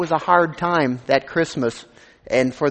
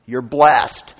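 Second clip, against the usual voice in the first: normal talking voice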